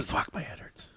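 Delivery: soft unvoiced whisper. Real, not acted. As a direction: whisper it, head aching. Whispered complaint.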